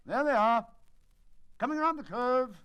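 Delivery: loud, high eager call; excited race call. Acted. Holmes calling the race through field glasses, loud and excited. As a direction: cheer them round the bend.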